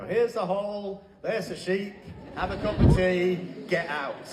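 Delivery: lightly sending up a lazy tour guide; a hint of a bored guide shooing people out, ending on a laugh; mock bored tour guide. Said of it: "I really thought it was gonna be".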